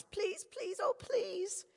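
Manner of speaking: high, tearful begging; a little girl begging through tears; tearful pleading girl. A narrator's reading. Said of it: "the tears burst from little Angela's eyes".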